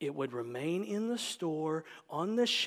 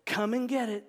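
raised Southern porch call; supper call; 4 semitones higher, 8 dB louder than usual. Acted. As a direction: call folks in to the table. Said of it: "ringing the dinner bell".

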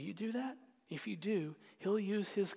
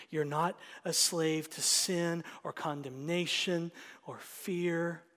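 usual speaking voice

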